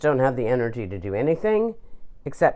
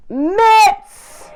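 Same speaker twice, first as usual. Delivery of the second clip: squealing delight; 9 dB louder than usual. Real, not acted. Gushing about oven mitts.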